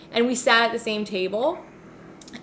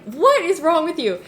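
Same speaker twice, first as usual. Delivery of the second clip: mock exasperated retort; 8 semitones higher, 5 dB louder than usual. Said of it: "And then I was like".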